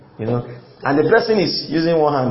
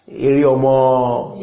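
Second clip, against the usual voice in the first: mock admiration; 4 semitones lower, much slower than usual. Admirer exclaims at child's car.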